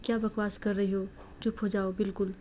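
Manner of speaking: plain reading voice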